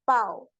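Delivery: पाँव read short as "paao", no nasal; nasal cut out. Shown as a demonstration. Urdu teacher reading it without noon ghunna, oral vowel. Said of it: "नून गुन्ना अगर चाहे हम cut कर देते हैं".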